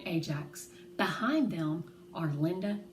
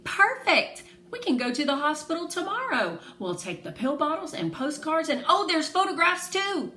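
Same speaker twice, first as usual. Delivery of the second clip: high, louder and eager; hopeful excitement; 9 semitones higher, 6 dB louder than usual. Acted. Girl eagerly plans the hospital trip, high and excited. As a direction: rush through the plan, full of hope. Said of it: "Hope rushes through my veins".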